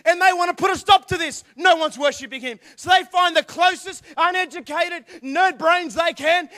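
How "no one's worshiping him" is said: ordinary talk, nothing marked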